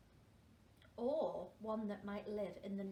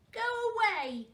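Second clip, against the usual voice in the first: high, loud "Go away"; bossy shout; 10 semitones higher, 10 dB louder than usual. Acted. Girl guarding her iceberg from a penguin, bossy high shout. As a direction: shoo the penguin off sharply. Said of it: "said Tofter".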